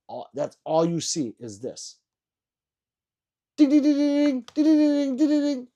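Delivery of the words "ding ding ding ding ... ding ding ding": very high, loud, rapid ringing dings; imitated steel-target dings. Streamer mocking YouTubers shooting big steel targets, loud high dings. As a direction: mimic bullets pinging off steel, loud and piercing.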